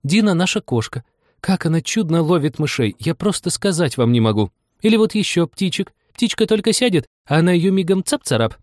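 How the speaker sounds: high, delighted gushing; joyful. A narrator's reading. Anya boasting about her beloved cat, joyfully. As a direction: brag happily in a high girlish voice. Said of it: "Аня ответила с радостью".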